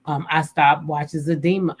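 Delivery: normal talking voice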